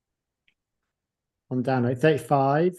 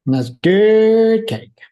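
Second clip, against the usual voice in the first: mock savoring; 10 semitones higher, 8 dB louder, much slower than usual. Echoes cake line, drawn out.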